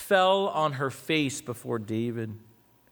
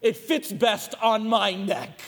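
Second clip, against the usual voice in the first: slow, high, dramatic entreaty; acted plea from a woman lying prostrate; pleading woman; 5 semitones higher, 4 dB louder, slower than usual. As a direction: beg to take the blow in another's place. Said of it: "I can just see her prostrate on the ground".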